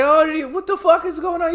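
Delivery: very high, loud, disbelieving; mock freaked out. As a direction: shriek in comic shock. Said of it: "And he's like".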